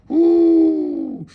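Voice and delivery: loud, high "ooh"; high hoot. Real, not acted. Preacher reacting to his own point, loud high hoot. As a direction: let out a sustained high whoop. Preacher hoots between exhortations.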